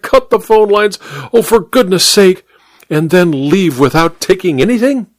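high, rising exasperation; agitated. A narrator's reading. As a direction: pile up the questions. Duchess insisting, agitated.

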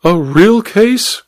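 high, drawn-out disbelieving question; slow, high-pitched astonished duchess; incredulous cry. A narrator's reading. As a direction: echo it back in outraged disbelief. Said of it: "cried the Duchess".

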